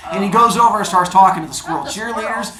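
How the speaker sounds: ordinary delivery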